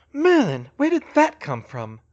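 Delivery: loud exclamation, high then dropping; baffled outburst on waking; startled. Acted. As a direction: burst out, shaken from a bad dream.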